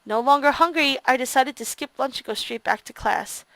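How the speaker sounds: low, soft narration read; story narration. A narrator's reading. Player quietly reads the narration aloud.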